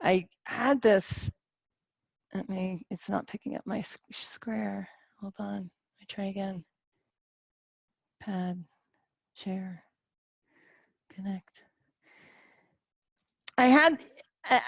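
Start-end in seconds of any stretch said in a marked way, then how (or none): 2.3-11.6 soft, half-whispered muttering to herself; quiet technical aside, partly whispered; muttered aside. Real, not acted. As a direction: mutter under breath while fixing the tech. Muttering to self over tech trouble.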